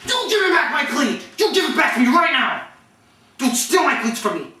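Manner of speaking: high-pitched, hammed-up scolding; angry demand. Player confronting teammate over stolen cleat, mock-furious. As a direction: act out furiously accusing a cleat thief.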